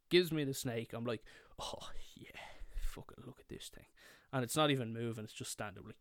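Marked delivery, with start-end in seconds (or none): 1.6-3.9 soft, whispery marvelling; hushed awe. Retold or imitated. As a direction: show it off under your breath. Retold awe while holding the snake.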